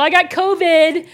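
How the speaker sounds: half-playful mock yell of bad news. Pastor imagining a covid patient warning people, mock yell. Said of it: "having to yell out to people".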